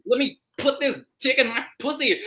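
mock lustful woman. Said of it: "she's like".

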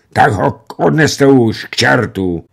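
loud, slow cry in a voice not his own; strained outcry. A narrator's reading. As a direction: cry out, choking back grief. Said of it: "vykřikl velitel cizím hlasem".

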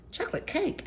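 Mummy Pig spotting the sneaky cake, surprised. Acted. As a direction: catch Daddy out with a high startled question. Mummy finds unlisted cake.

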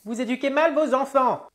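put-on high old-woman voice, reproachful; old dear telling someone off; old lady. Old woman blaming the mother, old-lady voice. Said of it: "l'ancêtre".

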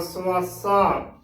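ਸਵਾਸਾ said slowly as "suaasaa"; slow model reading of ਸਵਾਸਾ, "su-aa-saa"; correct pronunciation. Shown as a demonstration. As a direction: draw out ਸਵਾਸਾ with a short u after the s. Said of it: "ਸੋ ਇਸ ਦਾ ਸ਼ੁੱਧ ਉਚਾਰਨ ਹੋਵੇਗਾ".

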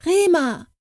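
high ringing call of a magic word. Acted. Katrin summoning the golden bird, high ringing call. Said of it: "rief sie".

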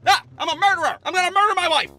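high, loud mock-menacing roar; bursting into the living room as a mock murderer; hammed-up murderer. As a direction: play a cartoon killer bursting in. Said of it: "do my best murderer impersonation".

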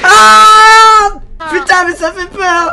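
high scared yelp, slowed; frightened scream. Real, not acted. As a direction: shriek in fright. Frightened by avatar.

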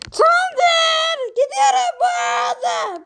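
high shouted question; shouting. Acted. Pumpkin asks the judge if he may talk by shouting, and yells it high. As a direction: yell it up high. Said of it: "Abi lütfen siz konuşma".

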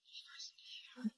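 slow, soft whisper of doubt; hesitant whisper. Acted. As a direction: breathe it out, unconvinced by the haircut. Doubtful reply to new haircut.

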